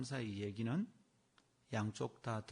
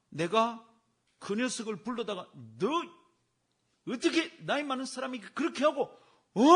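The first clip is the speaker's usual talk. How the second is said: mock scolding; 10 semitones higher, 7 dB louder than usual. Deacon's hoped-for scolding, retold.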